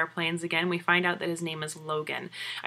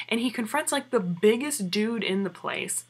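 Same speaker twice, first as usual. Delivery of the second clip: ordinary talk, nothing marked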